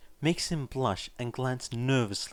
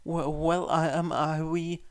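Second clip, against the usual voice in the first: flustered stammer; 7 semitones higher than usual. Acted.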